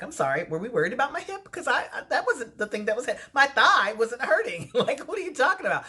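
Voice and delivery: high-pitched, baffled pushback; incredulous. Retold or imitated. To the doctor about her hip, high incredulous pushback. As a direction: she can't believe what she's hearing.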